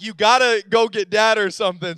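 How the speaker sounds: loud, urgent retold plea from a trapped kid. Stuck child tells brother to fetch Dad, urgently loud.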